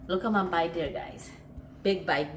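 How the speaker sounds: normal talking voice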